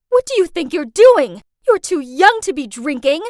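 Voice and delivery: very loud, outraged scolding; screaming mad. A narrator's reading. Mom catches underage daughter drinking, screaming. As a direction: shout them down in shock and fury. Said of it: "my mom screamed out".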